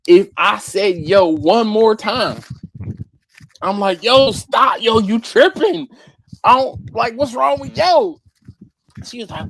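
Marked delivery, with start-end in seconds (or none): 4.0-8.2 loud, raised-pitch mock scolding; exasperated protest. Telling the tiny coworker she's tripping, loud high exasperation. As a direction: push back loudly in disbelief.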